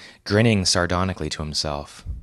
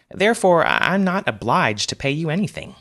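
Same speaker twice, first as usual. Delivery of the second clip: smug refusal; 9 semitones higher than usual. A narrator's reading. Wes smugly refuses payment.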